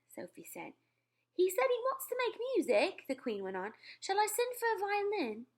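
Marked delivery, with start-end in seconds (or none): 1.3-5.5 much higher royal voice; kindly offering; the Queen. Acted.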